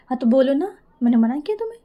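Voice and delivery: loud, challenging comeback; defensive retort. Acted. Ishani answers Mayra's complaint about interruptions, loud defensive retort. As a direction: push back loudly, daring the partner to speak.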